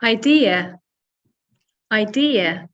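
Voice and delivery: "Idea" as eye-DEE-uh, three syllables; model English pronunciation. Shown as a demonstration. Teacher models the word for learners to match, clear "Idea". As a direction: say "Idea" twice, stressing DEE. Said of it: "I'll say the word".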